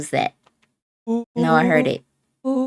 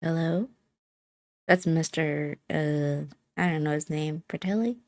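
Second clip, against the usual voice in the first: muffled, from another room; 9 dB quieter than usual. A narrator's reading. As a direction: sound muffled behind walls. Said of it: "A muffled male voice broke the silence".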